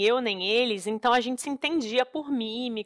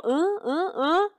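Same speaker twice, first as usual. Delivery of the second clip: puzzled grunts; 7 semitones higher, slower than usual. Retold or imitated. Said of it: "e o Daniel".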